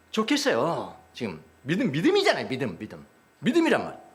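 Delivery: usual speaking voice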